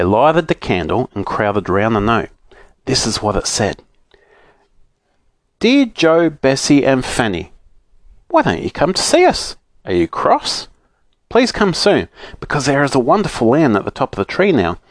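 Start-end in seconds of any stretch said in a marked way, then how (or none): 5.6-14.8 higher, lively letter-reading voice; reading letter aloud. A narrator's reading. Children reading Moonface and Silky's note, reading aloud. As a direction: read the note out in a lilting raised pitch.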